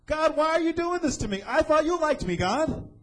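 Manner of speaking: high, whiny complaint to God; whining. Retold or imitated. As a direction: whine like you've been wronged. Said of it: "I don't see any whining".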